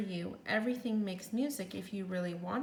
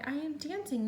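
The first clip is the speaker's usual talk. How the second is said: higher, delighted exclamation; joyful cry; 6 semitones higher than usual. Acted. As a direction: burst out with happy disbelief. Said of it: "Gerald cried".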